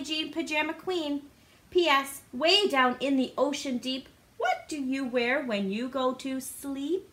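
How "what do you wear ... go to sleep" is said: ordinary delivery, nothing marked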